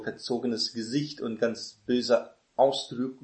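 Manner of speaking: ordinary talk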